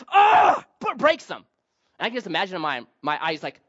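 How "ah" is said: loud, high furious roar; angry yell. Retold or imitated. Moses smashing the tablets, furious yell. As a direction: let out a raging yell as he smashes them. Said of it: "and he's like".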